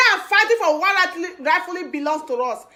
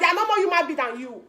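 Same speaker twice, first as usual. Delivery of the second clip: quick first take; faster than usual. Acted. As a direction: toss it off quickly before the repeat. Repeats line, second more emphatic.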